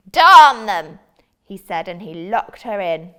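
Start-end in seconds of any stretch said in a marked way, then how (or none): 0.1-1.0 mocking wizard. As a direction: taunt her like a gloating wizard. Wizard orders princess.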